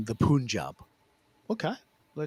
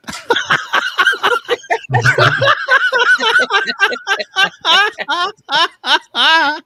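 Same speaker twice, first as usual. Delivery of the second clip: long, loud, high-pitched laugh; high cackling fit of laughter; big laugh; 9 dB louder than usual. Real, not acted. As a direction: crack up helplessly at your own joke. Cracks up at own bit.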